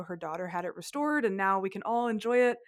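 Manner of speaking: usual speaking voice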